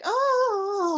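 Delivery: high exasperated mom sigh of oh; cliche sitcom mother. Retold or imitated. Imitating the cliche TV mother, a high exasperated oh. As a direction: sing out a weary motherly oh. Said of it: "the mother who's kind of like".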